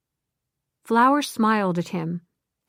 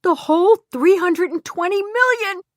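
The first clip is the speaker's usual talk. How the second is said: much higher, louder joyful scream; 11 semitones higher, 4 dB louder than usual. Acted. Glenda telling the group on the beach, screaming with joy. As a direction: shout the news to everyone. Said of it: "she screamed".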